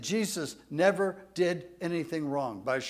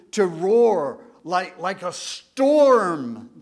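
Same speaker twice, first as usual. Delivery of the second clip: slow, louder, drawn-out roar; roaring storm; 5 dB louder, slower than usual. Acted. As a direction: let it rumble like a storm. Explaining the word for roaring storm.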